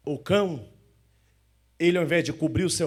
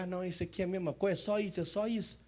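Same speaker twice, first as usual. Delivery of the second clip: mock bored grumble; 9 dB quieter, faster than usual. Mocking a bored churchgoer.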